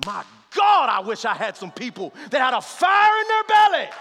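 loud pleading sermon cry; fiery preaching. Acted. Pastor crying out to God before the church, fiery preaching. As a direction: pray it out with fire.

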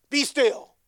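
loud, firm command to the storm; calm command. Acted. Jesus commands the windstorm, loud and firm. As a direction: order the wind to be still with full authority. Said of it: "Jesus, calm as ever".

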